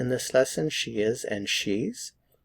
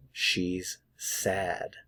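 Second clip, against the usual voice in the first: "She's ... sad", softer, with a clear gap between the words; 3 semitones lower, 6 dB quieter than usual. Shown as a demonstration. Teacher models "She's sad" for learners, slow and word by word. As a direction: separate "She's" and "sad" carefully. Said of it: "notice the vowel sound".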